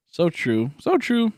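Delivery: loud, high mock agreement; loud emphatic mock agreement; hammed agreement. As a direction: ham it up loudly.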